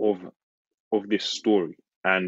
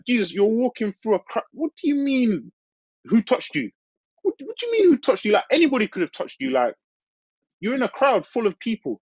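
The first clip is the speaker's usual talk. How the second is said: much higher, fast, incredulous disciples; high baffled disciples; 12 semitones higher than usual. Acted. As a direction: fire it off as the bewildered disciples. Preacher voices baffled disciples.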